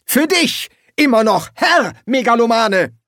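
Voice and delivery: high, loud and indignant; indignant correction. Acted. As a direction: put him firmly in his place, loud. Boss snapping a correction.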